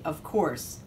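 ordinary delivery

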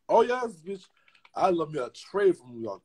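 ordinary delivery

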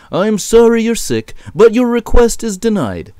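high-pitched, officious refusal; sorry but firm, pitched well up; apologetic shepherd boss. Acted. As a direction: deny the request in a high shepherd voice. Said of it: "the shepherd replied".

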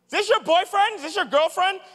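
high, loud mock-teasing call across the room; really loud teasing. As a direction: embarrass the kids with a loud, high taunt. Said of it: "Like, really loud".

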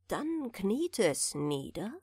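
plain reading voice

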